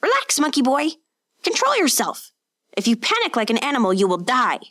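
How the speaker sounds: high urgent shout across the water; master shouting. A narrator's reading. Master shouting to his sinking pupil, high and urgent. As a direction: yell at the panicking boy to calm down. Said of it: "his master shouting at him".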